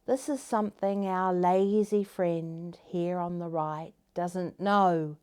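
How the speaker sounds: usual speaking voice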